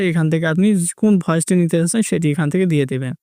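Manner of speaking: usual speaking voice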